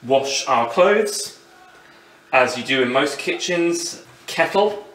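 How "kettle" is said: usual speaking voice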